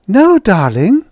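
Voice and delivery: Judy answering her husband joyously, bright and loud. Acted. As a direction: beam with joy. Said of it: "Judy replied joyously".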